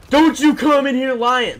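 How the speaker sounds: loud, high, stretched-out angry imitation; retold principal yelling. Principal cutting off a student's explanation, retold angry shout. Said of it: "He just fucking starts yelling at me".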